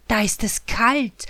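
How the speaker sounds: usual speaking voice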